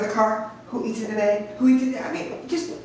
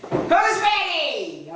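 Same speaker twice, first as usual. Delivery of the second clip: slow, drawn-out, higher call to the crowd; rallying call; 9 semitones higher, much slower than usual. Shown as a demonstration. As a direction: stretch it out like a hype man.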